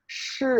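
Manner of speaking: shi with a quick falling tone; fourth tone. Shown as a demonstration. Teacher demonstrating the second syllable, shi in a falling tone. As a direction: drop shi sharply in fourth tone. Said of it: "y la segunda en cuarto".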